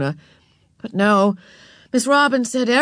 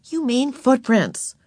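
higher, slower correction; correcting; 5 semitones higher, slower than usual. A narrator's reading. Beezus corrects her little sister's word, pointedly. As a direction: set a little sister straight. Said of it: "corrected Beezus".

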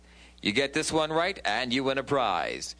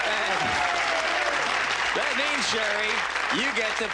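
rising high and loud to the reveal; excited announcement; 7 semitones higher, 3 dB louder than usual. Real, not acted. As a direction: build up the big reveal. Host announcing the bonus winner.

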